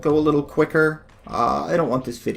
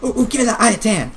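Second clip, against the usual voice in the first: mock greedy excitement; 7 semitones higher than usual. Greedy excitement over loot.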